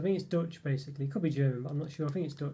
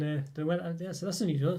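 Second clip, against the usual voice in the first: ordinary delivery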